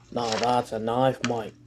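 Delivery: slow, soft mock Australian accent, landing on knife; the punchline in an Australian accent, proudly slow. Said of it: "You like my Australian accent?".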